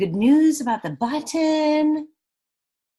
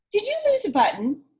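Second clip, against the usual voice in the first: sparrow voice; 11 semitones higher, 4 dB louder, faster than usual. Acted.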